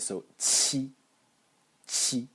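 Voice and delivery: qi with burst and hiss together; Chinese qi. Shown as a demonstration. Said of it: "the explosion and the noise".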